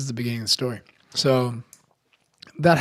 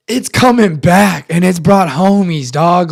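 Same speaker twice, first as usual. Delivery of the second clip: loud, higher, drawn-out alarm; retold panicked yell; 4 semitones higher, 9 dB louder, slower than usual. Teller warns friend as lights go out, panicked yell. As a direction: yell a slow, alarmed warning.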